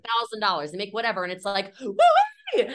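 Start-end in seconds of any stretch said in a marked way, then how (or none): 1.8-2.7 high, loud whoop with a laugh; laughing mock cheer; mock celebration. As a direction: holler it like a giddy rookie. Mocking salesperson celebrating a yes.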